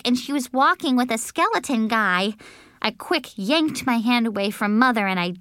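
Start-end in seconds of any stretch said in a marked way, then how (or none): none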